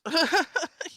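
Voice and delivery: sudden high, loud laugh; a real, bright burst of laughter. Real, not acted. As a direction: crack up at the mix-up. Laughs at accidental Google Docs.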